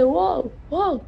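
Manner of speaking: high retold cry of alarm; mock shout to the old woman; calling out. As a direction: call out wow, wow up high. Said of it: "elle commence à crier".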